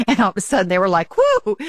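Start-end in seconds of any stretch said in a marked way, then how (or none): 1.2-1.6 high mock whoop; high excited cheer; imitated cheer. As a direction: whoop like thrilled staff. Staff cheering retold.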